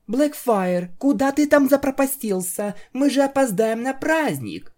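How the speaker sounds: put-on high, light girl's voice calling; female pony voice. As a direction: call out to him, high and impatient. Narrator voices Octavia calling.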